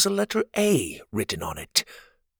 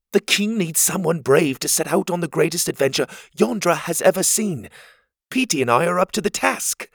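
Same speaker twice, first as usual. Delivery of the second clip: put-on a higher, bold young hero voice; eager boy hero; 7 semitones higher than usual. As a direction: boast like a brave little adventurer. Pocket voiced by reader.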